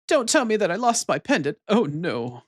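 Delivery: put-on high-pitched fretful girl, falling away; absent-minded girl. Absent-minded girl missing her pendant, high fretful voice. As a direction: play a scatterbrained heroine in dismay. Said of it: "Absent-minded girly here".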